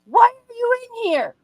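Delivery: very high, loud panicked yell; retold yelling back. Cornered in the shower, yelling back at dad. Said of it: "I'm yelling back".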